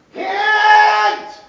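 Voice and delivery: loud call-out. Real, not acted. Teacher flagging exam material to students, a high loud shout. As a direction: sing out the cue like an alarm.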